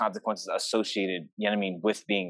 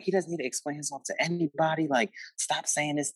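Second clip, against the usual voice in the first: pissed off; 7 semitones higher than usual. Retold or imitated. Little sister defending sibling to mom, mildly pissed off. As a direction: protest on someone's behalf, pitched up. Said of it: "would get so pissed off".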